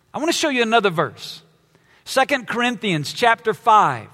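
normal talking voice

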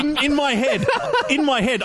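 high, laughing through the words; giggling as the image plays in his head. Real, not acted. Cracking up imagining Batman pedaling.

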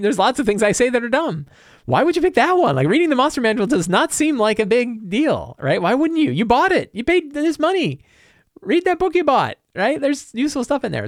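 can't believe they picked that one; exasperated disbelief. Real, not acted. Venting to listeners about Reddit critics, exasperated and high.

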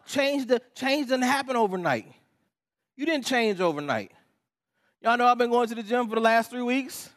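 high, loud, impassioned sermon delivery; fired-up preaching. Real, not acted. Preacher tells congregation change takes time, fired up. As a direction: drive it home at full force.

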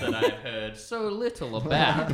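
high, hammed-up posh customer laughing through it; fake posh disguise voice. Friend playing a disguised local ordering craft beer, hammed-up posh. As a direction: put on a silly fancy voice to stay incognito. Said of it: "and you're like".

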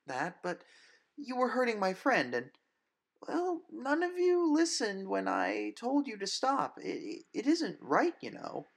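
put-on high, loud, stammering young woman; scared but standing firm; nervous brave girl. Grace nervously tells the vampire to stop.

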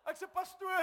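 high, loud comic wail; mock crying. Preacher wanting to cry with the estate agent, mock crying. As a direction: wail it out as if in tears. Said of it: "I wanted to cry with him".

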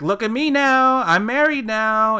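high, loud, slowing sing-song brag; mock bragging. Mimicking the bride waving her wedding papers, mock bragging. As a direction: show off loudly, stretching the words high.

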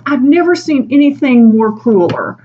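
hammed-up indignant line; mock appalled; outraged colleague.

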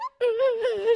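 high-pitched imitated ghostly wailing; hammed-up weepy moan; mock crying. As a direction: fake sobbing, half laughing. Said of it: "she's just crying and shit".

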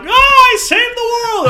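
high, loud, gloating shout; mock triumph. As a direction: shout it like a proud hero. Mocking Arya triumphant.

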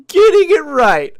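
high, loud, slow proud announcement; hammed-up executive proudly unveiling "Getting it right"; drawn-out mocking "Getting it right"; mock exec slogan. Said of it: "the new mantra at Lucasfilm is".

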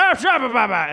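high, loud slurred nonsense; hammed-up babbling call; mock gibberish. The comic answering the heckler in loud gibberish. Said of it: "and I was like".

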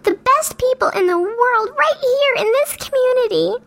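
normal talking voice

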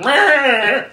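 high, loud monster bleat; comic creature yell; mock monster roar. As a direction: roar like a flustered monster swatting branches. Imitates monster roaring at branches.

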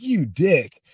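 high, heated telling-off; imagined angry outburst. Retold or imitated. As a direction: let him have it, as imagined. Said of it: "I wanted to slug him in the face".

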